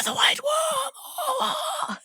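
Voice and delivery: very high, very slow, wailing cry; dying Viserys voice. Acted. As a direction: gasp it out like a dying king. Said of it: "my Viserys is wilting and dying voice".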